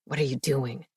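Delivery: low, quiet growl. Acted. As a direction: growl it at her under your breath. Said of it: "Marcus growled".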